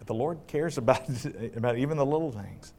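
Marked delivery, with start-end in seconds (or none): none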